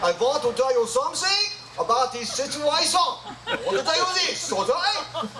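mock German accent, pitched high. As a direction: play a comic German with a giggle. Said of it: "I can also talk some German for you".

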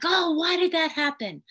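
high, loud, alarmed "Oh, why did that happen?"; intense reaction. Shown as a demonstration. Therapist showing an overreaction to a child, intense and loud. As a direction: overreact with a sharp, loud rise. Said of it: "sometimes we're very intense".

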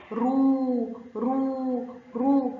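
رو with a long, held uu; long vowel. Shown as a demonstration. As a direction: draw out each رو slowly. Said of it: "صوت الراء صوت طويل".

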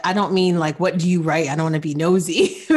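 usual speaking voice

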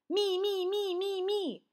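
very high, rapid pleading repeats; eager shout. A narrator's reading. Froggy wants to go first, eager shout. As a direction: clamour to be picked, high-pitched. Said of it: "Froggy shouted".

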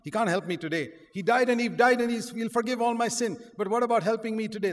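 ordinary delivery

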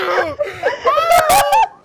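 loud high shrieking laugh. Real, not acted. Loud laugh after joke.

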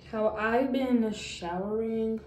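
ordinary talk, nothing marked

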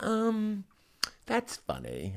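a hint of Mulaney, deadpan and hushed; Mulaney impression. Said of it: "that's a very good impersonation".

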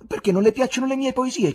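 a high, eager why; curious question. A narrator's reading. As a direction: ask out of genuine curiosity. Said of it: "chiese Ivan con curiosità".

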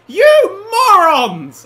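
loud, high, stretched-out insult; mock scolding. Player yelling at the guests who rode the coaster. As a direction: yell it at the guests, drawn out.

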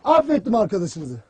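low, soft, falling away after the tirade; subdued pardon. Acted. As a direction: drop right down to grant the pardon. Commander relents after shouting.

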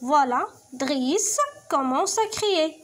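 slow, clear reading; slow teacher read; slow read-aloud. Shown as a demonstration. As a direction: read slowly for pupils. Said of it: "نرجعو للنص ديالنا".

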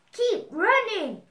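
loud, high storybook dog bark; barked command. A narrator's reading. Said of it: "barked the dog".

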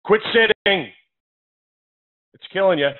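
mock slogan for a campaign against sitting. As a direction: sell it like a public health ad. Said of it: "it should be".

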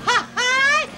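high, mocking "ha ha"; scornful laugh. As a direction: scoff at a rival's boast. Sarcastic laugh at rival's boast.

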